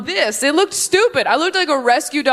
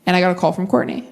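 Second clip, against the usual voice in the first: normal talking voice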